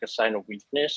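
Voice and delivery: ordinary talk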